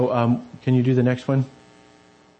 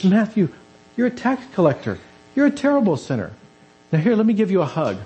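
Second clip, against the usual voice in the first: higher, amused and affectionate teasing; amused Jesus; 8 semitones higher than usual. Acted. Jesus teasing Matthew the tax collector with a fond, amused lilt. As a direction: tease him fondly, almost laughing. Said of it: "Jesus laughs and says".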